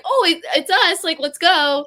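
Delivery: a jubilant shout to teammates; excited cheer. Retold or imitated. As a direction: burst out like you just won. Hearing their team name called.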